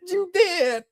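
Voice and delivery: high mock woman's voice; mocking her. Quoting her retort to viewers, in a high mock voice. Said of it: "She said".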